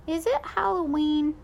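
put-on little brother quizzing the babysitter, high; curious toddler. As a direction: ask wide-eyed like a toddler.

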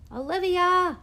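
high, loud urgent call; hush shouting. A narrator's reading. Teacher summoning understudy from the door, hush shouting. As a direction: call out sharply from a doorway. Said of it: "hush shouting from the door".

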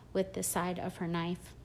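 plain reading voice

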